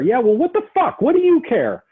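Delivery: high, loud hostile retort; acted angry client. Shown as a demonstration. Client lashing out at the counsellor, high and loud. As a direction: snap back like an agitated client.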